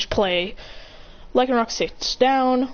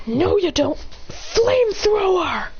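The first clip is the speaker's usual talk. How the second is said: high battle call, last word stretched; attack command; 9 semitones higher, slower than usual. Acted. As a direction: cut in, then draw out the move. Trainer shouts attack command.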